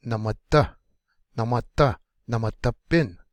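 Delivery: "Namata", "namata bin" with the final syllable kicked hard; stress on last syllable. Shown as a demonstration. Teacher modelling words for learners, last syllable stressed. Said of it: "kick the last one hard".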